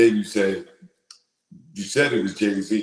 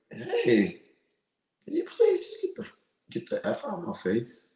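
overly polite, soft and pitched high on please; polite request demo; 8 semitones higher, 5 dB quieter than usual. Shown as a demonstration. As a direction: ask sweetly instead of yelling. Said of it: "say it".

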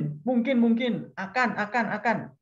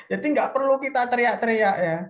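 ordinary delivery

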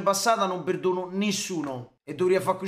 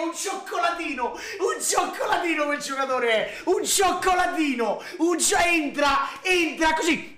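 raving praise; 6 semitones higher than usual. Real, not acted. Raving about Martial.

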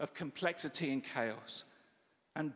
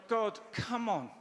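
grumpy; 7 semitones higher, slower than usual. Retold or imitated. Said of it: "quite spiritually grumpy".

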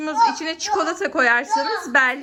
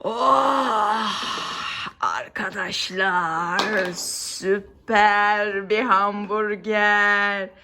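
savouring whisper; 6 semitones lower, much slower than usual. Pretend. Pretends to savour the burger.

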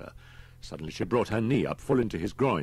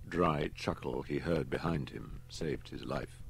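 ordinary delivery, nothing marked

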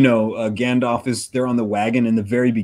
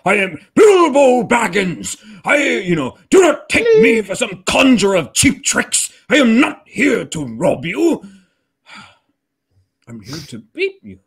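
slow, higher, louder mock hobbit speech; hammed-up Bilbo; 8 semitones higher, 3 dB louder than usual. Mocking movie lines for friends, hammed-up hobbit voice. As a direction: ham it up like a pompous movie hobbit. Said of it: "Or the whole, like".

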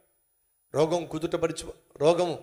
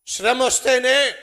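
emphatic; 5 semitones higher, 10 dB louder, slower than usual. Acted. Preacher emphatic repeat.

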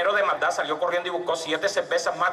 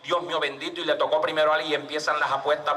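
usual speaking voice

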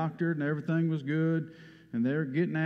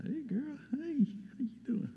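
sweet high greeting; 10 semitones higher, 7 dB quieter than usual. Real, not acted. Preacher greeting a small girl on the third row, high and soft. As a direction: coo a hello to a little one.

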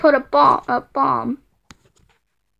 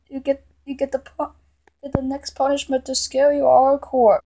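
usual speaking voice

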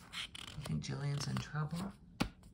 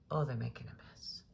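hushed whispered aside; 3 semitones lower, 4 dB quieter, faster than usual. Real, not acted. Reader whispers to the child about the mess. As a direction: whisper it conspiratorially.

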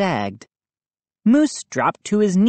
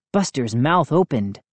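ordinary delivery, nothing marked

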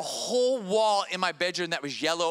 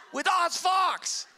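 starstruck; 11 semitones higher, much slower than usual. Retold or imitated. Starstruck fan at lunch.

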